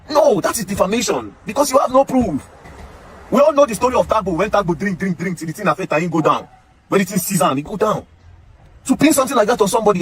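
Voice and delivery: high-pitched, heated protest; indignant. Real, not acted. Indignant rebuttal of accusations made without proof.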